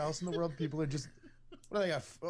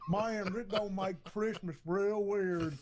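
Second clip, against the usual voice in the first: slow, higher hillbilly voice; caricatured hillbilly, drawn out and raised; mock hillbilly drawl; 4 semitones higher, slower than usual. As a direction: stretch it out in a hillbilly twang. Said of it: "speaking of hillbilly".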